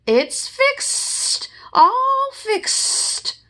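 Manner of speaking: high, whistly cheerful voice; whistled Gopher. Acted. Gopher finishing the sign, high whistling voice. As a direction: whistle the words through your teeth. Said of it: "whistled Gopher".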